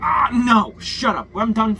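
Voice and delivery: loud dismissive mock yell; mock fact checker shout. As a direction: bark it like a fed-up fact checker. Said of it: "some fact checker was like".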